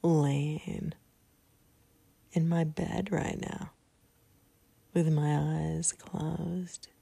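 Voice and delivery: calm, spaced-out phrases with long pauses; guided meditation. Real, not acted. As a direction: lead them gently into relaxation, a few words at a time. Leads the sleep meditation.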